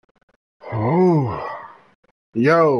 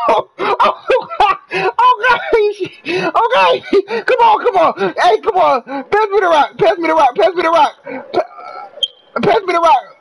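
frantic high shouting; 13 semitones higher than usual. Real, not acted. Streamer shrieking at his teammates in real frantic excitement. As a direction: yell in near-crying panic as the play falls apart. Screaming at teammates during game.

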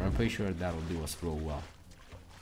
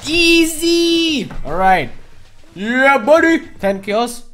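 loud, high victory cheer; dawning joy; 14 dB louder, much slower than usual. Real, not acted. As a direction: cheer the win. Celebrating kills.

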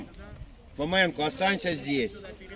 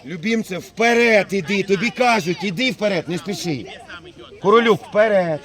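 loud coach orders; yelling across the field; 8 dB louder than usual. Real, not acted. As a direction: bark it out across the pitch. Coach shouting orders at players.

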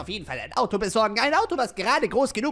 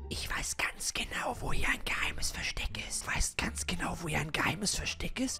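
hushed secretive whisper; conspiratorial whisper; 3 semitones lower, 10 dB quieter than usual. Acted. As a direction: whisper so nobody overhears. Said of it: "leise sein".

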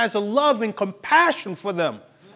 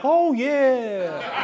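drawn-out, higher-pitched naive reply; oblivious agreement; 4 semitones higher, 3 dB quieter than usual. Acted. Churchgoer blind to who people really are, obliviously agreeable. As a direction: play someone blissfully missing the danger.

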